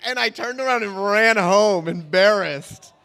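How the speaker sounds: ordinary talk, nothing marked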